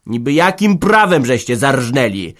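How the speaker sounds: loud, agitated demand; agitated shout. Acted. Smith shouts at Hanka over the pig, agitated. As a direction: shout it, riled up. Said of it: "wykrzyczał wzburzony".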